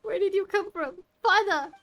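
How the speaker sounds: startled yelp, jumping high; shocked cry at a sudden appearance; startled shout. Real, not acted. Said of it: "You scared Paimon half to death!".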